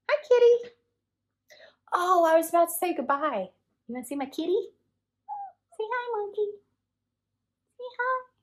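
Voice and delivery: very high pet-talk coo; talking to cat. Real, not acted. Cooing at her cat.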